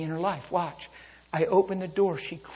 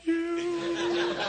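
much higher, drawn-out sung blessing; hammed-up relieved old woman; relieved sing-song lady; 13 semitones higher, 7 dB louder, much slower than usual. Crash victim blesses the preacher, slow sung relief.

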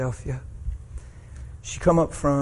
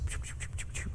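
soft unvoiced choo sounds; whispered choo; 16 dB quieter, faster than usual. Pastor quietly voicing a sound at the boy, whispered. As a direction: breathe short shushing choos.

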